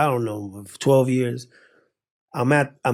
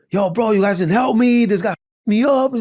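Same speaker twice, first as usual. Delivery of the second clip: higher-pitched, aggrieved retelling; retold indignant complaint; 7 semitones higher, 3 dB louder than usual. Telling his boys off in the limo, acted indignation. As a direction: complain to your friends, hammed up.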